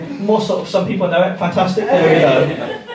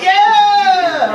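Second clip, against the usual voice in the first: high cheer like a goal scored; goal celebration; 13 semitones higher, 4 dB louder than usual. Retold or imitated. Comic at the telly, cheering like a goal. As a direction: cheer as if your team scored.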